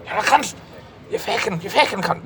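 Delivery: high-pitched bad Scottish accent; terrible Scottish accent. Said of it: "pardon my terrible Irish accent".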